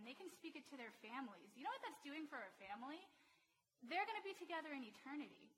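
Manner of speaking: ordinary talk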